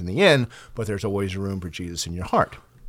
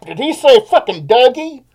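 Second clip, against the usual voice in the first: disbelieving shout; 14 semitones higher, 9 dB louder than usual. Retold or imitated. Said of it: "He's like".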